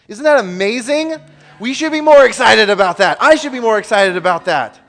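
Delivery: loud, high exhortation; rally the congregation; fired-up sermon climax; preaching at full force. Acted.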